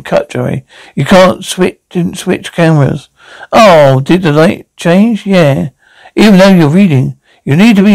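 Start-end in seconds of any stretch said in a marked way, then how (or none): none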